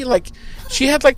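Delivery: ordinary talk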